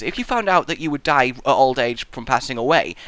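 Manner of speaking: ordinary talk, nothing marked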